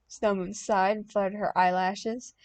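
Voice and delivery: ordinary delivery, nothing marked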